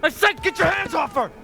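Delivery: high, loud enraged demand; furious shout. Acted. Father yelling at soldiers to release his daughter, furious. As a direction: bellow at the soldiers.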